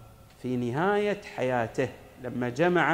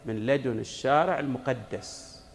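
ordinary delivery

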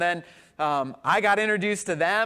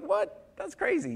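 high leap of disbelief on crazy; playful mock shock; surprised; 11 semitones higher than usual. Pastor retelling the barbecue invite, surprised. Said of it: "And I was like".

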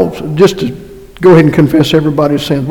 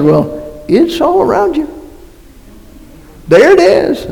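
much higher-pitched, incredulous insistence; incredulous retort; 11 semitones higher than usual. Retold or imitated. Preacher answering people who deny creation, high incredulous retort. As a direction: pitch it high, pointing out the obvious.

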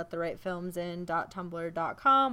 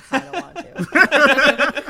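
laughing; 7 semitones higher, 13 dB louder, slower than usual. Real, not acted. Laughing admission.

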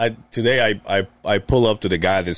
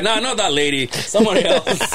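laughing quote; 9 semitones higher, slower than usual. Retold or imitated. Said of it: "He's like".

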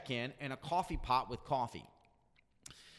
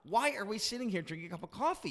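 inner disbelief; 6 semitones higher than usual. Retold or imitated. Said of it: "And I'm thinking".

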